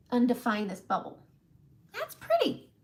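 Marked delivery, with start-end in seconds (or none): 1.9-2.7 sudden squeaky high voice. Real, not acted. Admiring her lips in a squeaky high voice.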